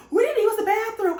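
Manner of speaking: high, loud irritated protest; annoyed complaint. Retold or imitated. Girls outside the bathroom complaining, high and loud. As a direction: call it out, fed up with waiting.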